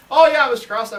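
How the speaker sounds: put-on loud, high, bright "oh yeah" to an old teacher; eager greeting. Restaurant owner greets his old teacher, loud and eager. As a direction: call it out with sudden delight.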